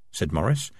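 ordinary delivery

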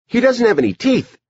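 put-on high-pitched girl character; Edie. A narrator's reading. Girl tells her brother about the man, high voice. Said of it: "Edie said".

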